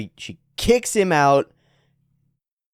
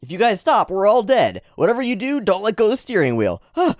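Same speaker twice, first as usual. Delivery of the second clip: high, urgent panicked character; panicking comrade; 10 semitones higher, 4 dB louder than usual. Acted. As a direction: blurt out orders and dread. Voices panicking character in manga.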